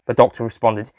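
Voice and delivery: plain reading voice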